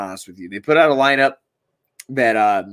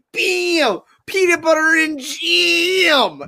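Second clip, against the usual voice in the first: high, slow sing-song cheer; goofy jingle voice; 13 semitones higher, much slower than usual. Retold or imitated. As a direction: belt it like a cheesy catchphrase. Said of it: "It's like".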